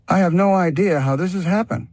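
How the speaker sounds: high, bewildered voice; surprised. Acted. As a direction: say it baffled and amazed. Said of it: "He responded in surprise".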